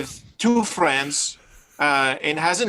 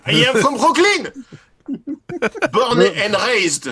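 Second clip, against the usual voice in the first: laughing, hammed-up Brooklyn accent; loud joking Brooklyn accent, laughing; 8 semitones higher, 6 dB louder than usual. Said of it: "Brooklyn accent?".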